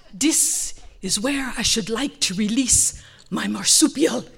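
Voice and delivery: slow, majestic proclamation; grand Lion King. Retold or imitated. Husband announces release to wife, Lion King grandeur. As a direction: proclaim it slowly like royalty. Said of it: "he gets all Lion King on me".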